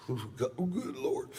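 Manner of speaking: high, soft, breathless delight; stunned, high-pitched murmur of pleasure; savoring amazement. Retold or imitated. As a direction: swoon over an incredible drink. Acted reaction to tasting scotch.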